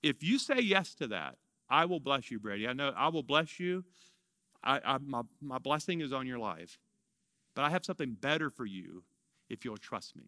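soft, low, tender reassurance; very gentle. Retold or imitated. The Lord reassuring him about trust, very gently. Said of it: "said very gently to me".

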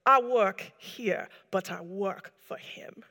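imitated Caribbean accent, slow and deliberate; Simple Paul. Simple Paul explaining whom he works for, slow Caribbean accent. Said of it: "I have to do the accent".

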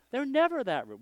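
puzzled disbelief. Retold or imitated. Pastor recalling his thoughts about the laughing congregation, puzzled. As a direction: pitch it way up in mock bafflement.

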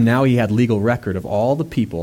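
ordinary talk, nothing marked

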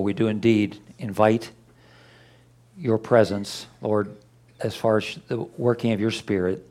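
low, soft, unhurried praying voice; hushed pastoral prayer to open the service; quiet prayer. Real, not acted. Pastor leads an opening prayer.

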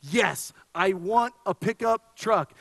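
slow, stressed "want" in "I want a pickup truck"; drawn-out, insistent "Yes, I want a pickup truck"; emphatic yes. Retold or imitated. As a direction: lean hard on the word "want". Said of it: "I would answer with an emphatic".

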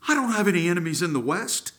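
high, fast shriek of outrage; a king shrieking in disbelief; mad king ranting. Acted. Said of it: "And the king shrieked".

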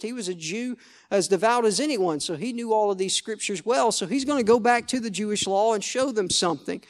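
ordinary talk, nothing marked

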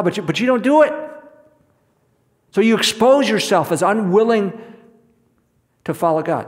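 high, loud preaching rebuke. Real, not acted. Preacher calls out the congregation, loud and high. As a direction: raise it against hypocrites.

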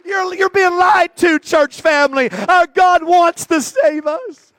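fiery sermon, shouted and slow; preaching at full force. Acted. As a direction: preach it out loud to the church.

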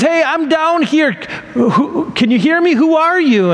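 mock calling out, pitched high. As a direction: act out hailing someone far above. Trapped Jack calls up to voice.

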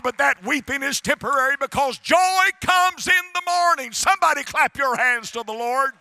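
high, loud, driving preacher cadence; full-force preaching. Real, not acted. Preacher promising the congregation a healer, full-force preaching.

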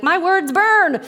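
high, drawn-out comic wail; mock burning-tongue cry. Imagined reaction to burning tongue.

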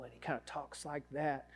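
a light, soft imitation of a Mississippi drawl; just hint at a slow Southern Mississippi drawl, half under the breath. Pastor lightly imitating his mentor's Mississippi drawl for the congregation. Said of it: "so he has this drawl".

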